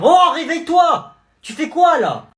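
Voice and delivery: high, loud, slow shout; yelling wake up. Acted. Friend yelling at the sleeping boy to wake. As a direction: shout him awake.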